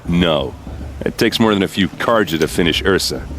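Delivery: ordinary talk, nothing marked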